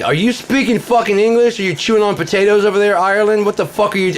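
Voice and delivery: high, loud taunting rant; mocking yell. Shouting back at the troll in his game room. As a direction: yell the insult back at him. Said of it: "I'm like".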